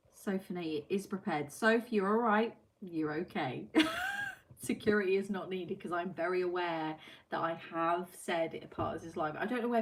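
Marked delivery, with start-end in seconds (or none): 3.7-4.5 high squeal of a laugh; a quick high giggle; tiny high-pitched laugh; squeaky laugh. Real, not acted. Squeal after reassuring Sophie.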